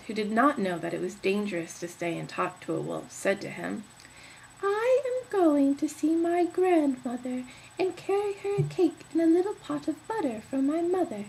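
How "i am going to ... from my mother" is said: put-on high little girl voice, near tears; a small child piping up to the stranger; high, teary child's voice; girl's voice.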